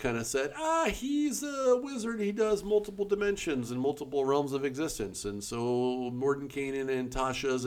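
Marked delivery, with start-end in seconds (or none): 0.6-5.3 a lightly hammed-up know-it-all voice; mock wizard voice. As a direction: toss it off like a mock grand wizard. Mocking wizards' makers casually.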